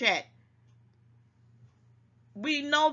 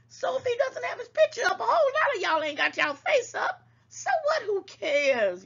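exasperated; 12 semitones higher than usual. Real, not acted. Exasperated defense of Sir King.

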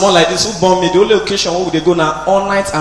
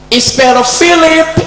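commandant calling; 9 semitones higher, 3 dB louder, slower than usual. Retold or imitated.

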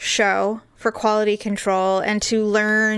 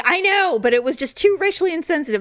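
quick apologetic producer; 7 semitones higher, faster than usual. Retold or imitated. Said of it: "He's like".

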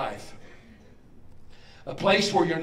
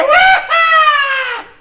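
huge high game-show winner's whoop; long, piercing joyful yell; scream of joy; 14 dB louder than usual. Acted. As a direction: shriek like you won the grand prize. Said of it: "that's a scream of joy".

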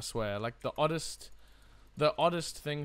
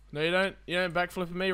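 normal talking voice

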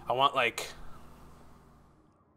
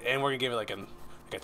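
normal talking voice

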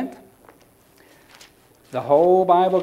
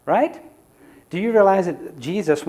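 ordinary talk, nothing marked

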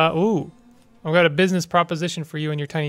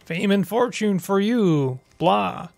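Count Blah voice. Acted. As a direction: play it like a puppet vampire count. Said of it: "Did you just imitate Count Count?".

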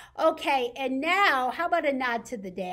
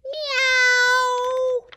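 cat meow; 9 dB louder than usual. Acted. Reading, imitating the cat.

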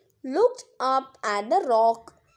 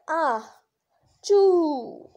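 acted "ah-choo" with a higher, drawn-out "choo"; second dragon sneeze; 4 semitones higher, 3 dB louder than usual. Dragon's second blast splitting the earth, drawn-out "ah-choo". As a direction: burst into a long falling "choo". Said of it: "The second blast split open".

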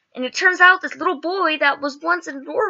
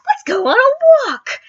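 high, louder, slow singsong; cheerful suggestion; 14 semitones higher, 7 dB louder than usual. Retold or imitated. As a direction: brightly invite a walk. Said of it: "and we're like".

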